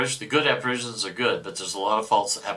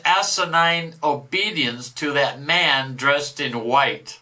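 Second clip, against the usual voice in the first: a boastful devil, louder than the commentary; 6 semitones higher, 5 dB louder, slower than usual. Acted. Devil boasting of stolen souls, mock devil voice. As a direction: read it as the gloating devil. Reader voicing the devil's message.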